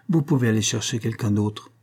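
ordinary delivery, nothing marked

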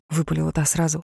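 usual speaking voice

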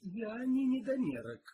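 loud, high, drawn-out protest; heated objection. A narrator's reading. Detective rejects the cook's insult, heated. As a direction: protest hotly, stretching the words. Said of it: "горячо возразил Пил".

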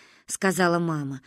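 ordinary delivery, nothing marked

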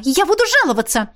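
high-pitched indignant threat; affronted warning; angry threat. A narrator's reading. Woman threatens rector in a quarrel.

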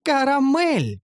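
put-on high proud elf girl calling her name. Elf slave girl tells her new master her name, high and proud. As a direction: answer brightly as the young elf.

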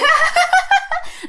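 very high, eerie cackle; high staccato witchy laughter; ghost woman's laugh. Retold or imitated. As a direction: imitate a phantom's piercing laugh. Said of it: "Đó, bà cười vậy đó".

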